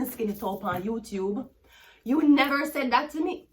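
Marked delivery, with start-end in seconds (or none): 2.0-3.5 loud, high indignant shout; angry yell. Real, not acted. Scolding the people who judge her, loud angry shout. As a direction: throw it back at them, furious. Ranting at critics.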